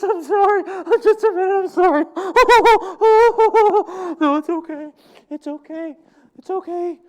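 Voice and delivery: high, loud laughter through the words; can't keep a straight face; breaking up laughing. Real, not acted. Preacher cracks up mid-skit, laughing out loud.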